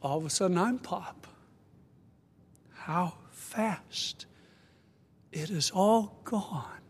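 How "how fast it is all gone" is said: hushed, slow whisper; whispered refrain. Real, not acted. Pastor to congregation, closing the memory of his grandson, slow hush. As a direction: let the words fall away to a bare whisper.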